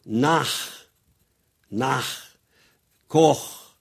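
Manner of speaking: "nach", "Koch" with rasped back ch; "nach", "Koch" ending in guttural kh; German CH. Shown as a demonstration. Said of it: "Et en allemand".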